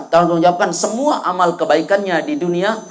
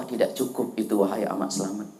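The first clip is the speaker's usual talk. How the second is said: low, soft, solemn address; God's verdict; 10 semitones lower, 10 dB quieter than usual. Acted. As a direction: pronounce judgment quietly and low. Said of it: "Kata Allah". (suara Tuhan, rendah dan pelan)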